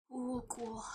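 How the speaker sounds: soft breathy whisper of cool. Acted. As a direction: whisper it under your breath.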